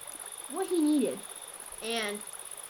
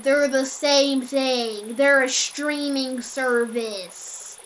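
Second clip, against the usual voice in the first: very slow, loud, drawn-out insistence; retold creepy caller; insistent creep; 2 semitones higher, 8 dB louder, much slower than usual. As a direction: lean on every word like a stubborn creep.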